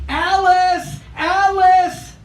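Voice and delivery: high, loud warning call; shouting. Acted. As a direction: call out a warning across the street. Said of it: "I heard someone shouting".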